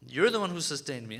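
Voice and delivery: ordinary delivery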